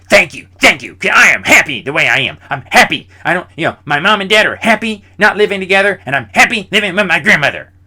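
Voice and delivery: mimicking classmate. Classmate interrupts his apology.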